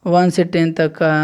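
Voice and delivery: usual speaking voice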